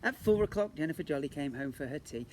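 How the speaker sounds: ordinary delivery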